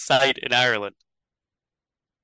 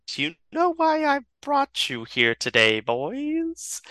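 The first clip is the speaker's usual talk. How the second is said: high, slow, drawn-out villain question; taunting villain; 12 semitones higher, 4 dB quieter, slower than usual. Acted. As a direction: toy with your captives, high and slow. Villain taunts her captives.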